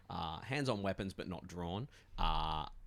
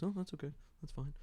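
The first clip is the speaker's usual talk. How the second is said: Zetsu; 9 semitones higher, faster than usual. Acted. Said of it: "And Zetsu goes".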